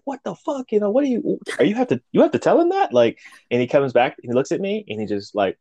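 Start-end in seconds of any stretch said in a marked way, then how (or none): 0.0-2.9 high-pitched, baffled protest; retold indignant disbelief. Newcomer snubbed at a handshake, indignant. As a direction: demand an explanation in a much higher voice.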